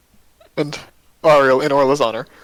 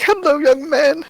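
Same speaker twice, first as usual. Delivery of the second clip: put-on high, slow old lady greeting; sweet granny saying hello; 12 semitones higher, 4 dB louder, slower than usual. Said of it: "I can't do an old lady voice".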